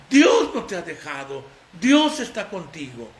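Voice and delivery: ordinary talk, nothing marked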